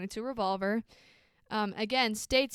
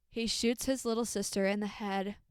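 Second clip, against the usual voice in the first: normal talking voice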